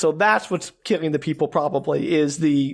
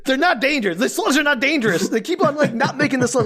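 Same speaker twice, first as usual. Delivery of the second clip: exasperated protest; 7 semitones higher than usual. Real, not acted. Exasperated rant about harmless slugs.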